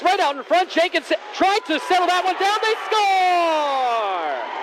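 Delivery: long goal yell. Real, not acted. Goal call in hockey play-by-play.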